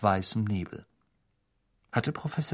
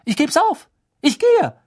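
furious exit; 8 dB louder than usual. Acted. Hermine storming out.

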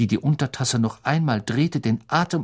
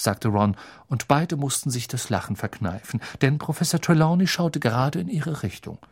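plain reading voice

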